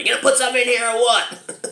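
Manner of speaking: high-pitched imitation of a pushy street yell; mock yelling. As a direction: holler it at passers-by in mock annoyance. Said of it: "just yelling at people".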